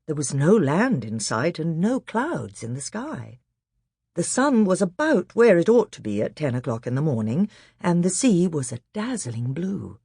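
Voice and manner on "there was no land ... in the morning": ordinary delivery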